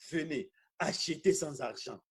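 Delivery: ordinary talk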